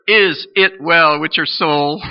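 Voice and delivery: preacher booming the question slowly; loud, slow question. Acted. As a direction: press the question on the whole church.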